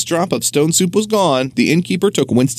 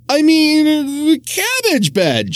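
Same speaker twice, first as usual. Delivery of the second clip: high, rushed cover-up; flustered farmer's wife; 14 semitones higher, much slower than usual. Acted. Farmer's wife covering her slip, high and flustered. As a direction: fluster out a quick excuse. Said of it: "she quickly explained".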